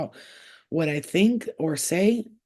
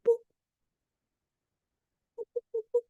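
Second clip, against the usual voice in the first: tiny, high-pitched boops; playful boops; much faster than usual. Real, not acted. Owner booping her dog, tiny high boops. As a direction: squeak out little boops at a pet.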